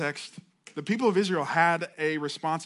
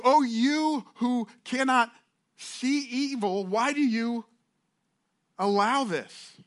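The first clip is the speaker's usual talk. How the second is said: higher, slow pleading question; anguished plea; 7 semitones higher, slower than usual. Acted. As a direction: draw it out, begging God to explain. Habakkuk pleading with God.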